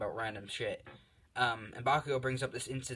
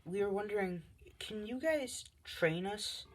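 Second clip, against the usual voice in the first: much higher, slow, sugary plea; sweet girls asking; 9 semitones higher than usual. Acted. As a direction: ask nicely like two eager girls. Two girls ask shyly for training.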